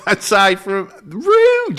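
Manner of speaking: very high-pitched squeal on "rude"; mock offence. Real, not acted. Co-host reacting to being called annoying, mock offended. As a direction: shoot up high in mock outrage.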